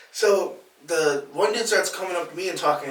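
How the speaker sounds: normal talking voice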